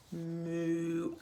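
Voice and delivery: low mooing cow. Acted. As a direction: moo like a cow. Cow moos in story.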